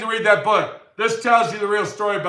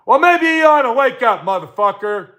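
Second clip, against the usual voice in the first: higher and louder, scolding; angry wake-up call; 4 semitones higher, 4 dB louder than usual. Real, not acted. Telling viewers like his friend to wake up, raised voice. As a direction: shout them awake.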